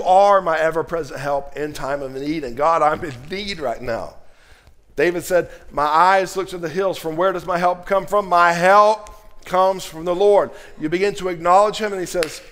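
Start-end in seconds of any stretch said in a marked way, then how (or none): 4.9-12.4 louder, rising preacher's cry; preaching. Acted. Pastor stirring the congregation, preaching at full force. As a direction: preach it out with fire.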